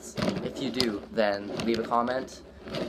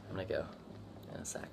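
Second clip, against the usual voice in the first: quiet; 2 semitones lower, 21 dB quieter, faster than usual. Real, not acted. About to hold breath again.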